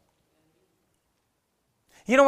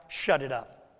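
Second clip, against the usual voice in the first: low, curt parental order; a hint of a stern parent; parent's command; 8 semitones lower, 5 dB quieter than usual. Parent silencing a noisy child, low and curt. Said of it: "as a parent when you have that kind of power".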